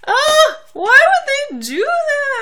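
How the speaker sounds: high, sung mock anguish; dramatic wail. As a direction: wail it like heartbreak.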